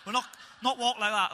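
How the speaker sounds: usual speaking voice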